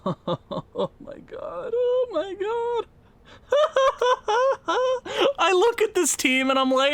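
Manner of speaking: high, strained laughter near tears; laugh-crying. Real, not acted. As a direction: laugh through the despair. Laugh-crying over the losing team.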